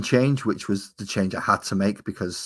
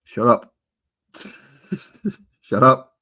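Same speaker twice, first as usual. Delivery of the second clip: loud, laughing shut up at bad news; jokey loud protest; mock outrage; 3 semitones higher, 7 dB louder than usual. Real, not acted. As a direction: shout it down playfully, half laughing. Told Christmas is three months away.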